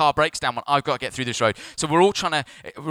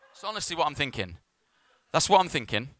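usual speaking voice

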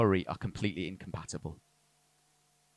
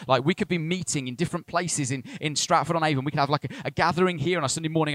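higher, quicker, more northern as excitement builds; excited northern; 7 semitones higher, 4 dB louder than usual. Real, not acted. Ian sharing the church vision, rising excitement. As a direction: caught up in the vision, accent creeping in. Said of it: "you get more northern".

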